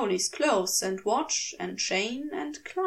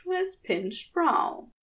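ordinary delivery

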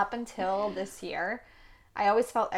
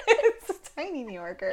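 laughing; 7 semitones higher than usual. Real, not acted. Cooing, laughing over son.

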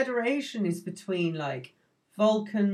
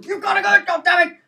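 much higher, loud imitated captain's yell; a hammed-up captain losing his temper; furious shout; 13 semitones higher, 9 dB louder, faster than usual. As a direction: blow up at the crew member, full mock fury. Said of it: "And he's really angry".

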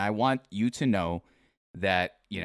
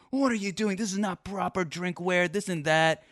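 higher-pitched mock scolding mom; imitating angry mom; 7 semitones higher than usual. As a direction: play his nagging mom, half-hammed. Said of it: "my mom got so mad at me 'cause she's just like".

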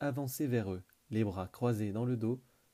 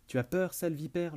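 teasing; 6 semitones higher than usual. Acted. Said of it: "le taquina Liam".

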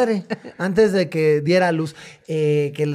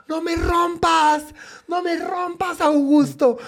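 high-pitched, drawn-out retold wail; mock scream; 10 semitones higher, slower than usual. Bride crying out to the fleeing groom at the wedding, high mock scream. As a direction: imitate a jilted bride screaming, hammed up. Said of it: "ella empieza a gritar".

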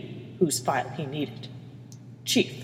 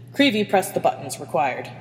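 ordinary delivery, nothing marked

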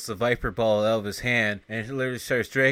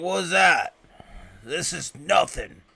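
higher, louder, scoffing tough guy; mocking brute; 7 semitones higher, 6 dB louder than usual. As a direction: brush it off like an unstoppable brute. Bronson voiced, shrugging off Viper.

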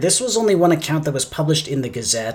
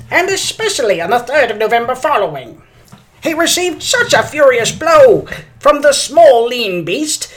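high, loud, slow vintage announcer; drawn-out newsreel drama; old-timey radio voice; 8 semitones higher, 5 dB louder than usual. Pretend. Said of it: "I'm going to use my old-timey radio voice".

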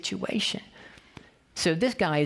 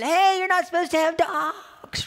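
put-on much higher, louder kid's yell; imitating a yelling boy; 13 semitones higher, 4 dB louder than usual. Ten-year-old son scolding the dog owners, imitated high yell. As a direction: ape the son chiming in with his father. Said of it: "He's yelling".

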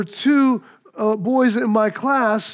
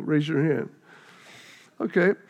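lower, softer aside to the room; quiet aside; 7 semitones lower, 5 dB quieter than usual. Real, not acted. Pastor asking congregation to raise hands, soft aside. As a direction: drop the voice, just asking the room.

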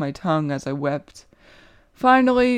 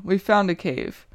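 plain reading voice

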